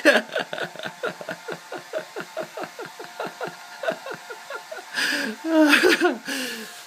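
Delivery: high, long peal of laughter; long giggle. Real, not acted. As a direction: keep giggling helplessly. Parent and baby laughing.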